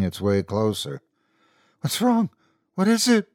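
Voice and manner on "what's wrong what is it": high, panicked questions as Megan; alarmed cry. A narrator's reading. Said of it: "Megan cried".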